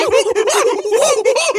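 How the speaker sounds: loud high manic cackle; shrieking laugh. Acted. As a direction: cackle like a mad monkey. After the panic.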